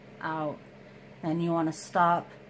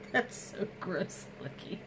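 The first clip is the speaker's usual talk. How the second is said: real whisper, much softer; whispered through laughter; 6 dB quieter than usual. Real, not acted. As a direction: whisper it while stifling a laugh. Disgusted at product on sponge.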